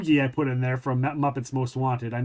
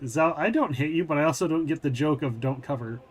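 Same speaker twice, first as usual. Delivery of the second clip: ordinary delivery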